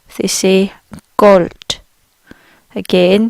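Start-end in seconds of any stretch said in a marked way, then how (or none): 1.2-1.8 Gold said with an open o and a final T sound; German Gold. Shown as a demonstration. Teacher showing how Germans say gold, T-like ending. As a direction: say Gold as golt, short and firm. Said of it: "the way they pronounce gold is very different".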